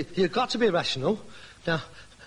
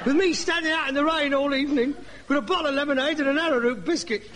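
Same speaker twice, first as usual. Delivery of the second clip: high, indignant complaint; indignant, pitched-up blaming; aggrieved accusation; 6 semitones higher than usual. Acted. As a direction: hurl old grievances in a raised pitch. Son blames father in a quarrel.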